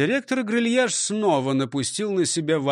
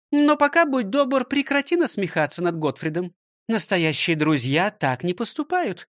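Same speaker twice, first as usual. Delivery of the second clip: higher, scolding schoolmistress voice; stern old teacher; 4 semitones higher than usual. Acted. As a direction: lecture him like a strict teacher. (строгая учительница)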